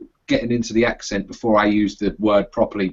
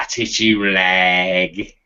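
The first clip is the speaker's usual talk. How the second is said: Sweeney copper; 6 semitones lower, much slower than usual. Retold or imitated. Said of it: "The Sweeney".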